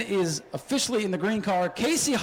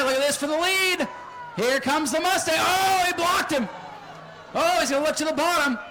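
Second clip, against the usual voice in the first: raised race-call voice, pushing forward; live play-by-play; 5 semitones higher than usual. Acted. Announcer calling the race for the crowd, raised play-by-play.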